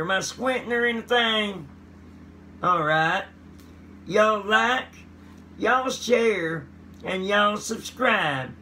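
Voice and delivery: high hillbilly voice; hillbilly twang asking for subscribes; hillbilly accent. Pretend. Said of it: "when I talk like that".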